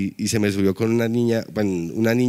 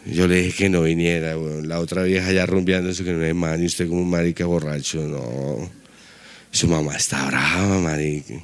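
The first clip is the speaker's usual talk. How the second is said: put-on much lower, slurring drunk mumble at his own reflection; drunk talking to himself; 8 semitones lower than usual. Drunk man scolding himself in the mirror, low slurred mutter.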